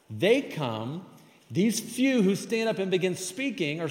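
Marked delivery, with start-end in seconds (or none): none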